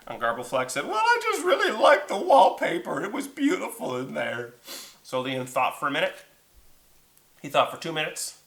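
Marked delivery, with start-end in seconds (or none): 0.9-4.5 high creature voice, falling at the end; high-pitched dragon excuse; whiny dragon. Acted. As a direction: plead your case in a high voice. Dragon explains himself tearfully.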